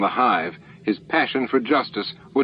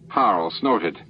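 usual speaking voice

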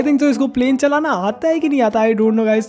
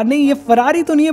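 usual speaking voice

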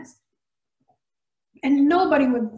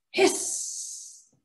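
1 hiss; 4 dB quieter than usual. A narrator's reading. Cat hissing sound.

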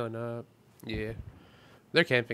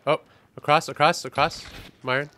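calling out; 3 semitones higher, 9 dB louder than usual. Real, not acted. Calling out to teammate Myron.

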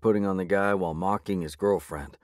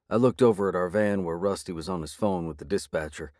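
ordinary delivery

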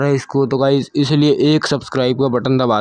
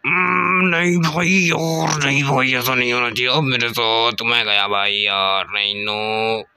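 long moaning lament, sinking lower; drawn-out wail that sags into defeat; despairing whine; 3 semitones lower, slower than usual. Real, not acted. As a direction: groan through the words as you lose. Lamenting as he dies in game.

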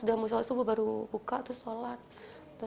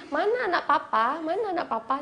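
calling out; 7 semitones higher, 5 dB louder than usual. Retold or imitated. Late father calls for his son on coming home, loud call. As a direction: call out fondly for the child.

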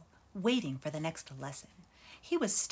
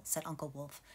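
usual speaking voice

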